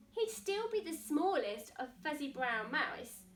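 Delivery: usual speaking voice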